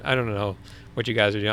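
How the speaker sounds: normal talking voice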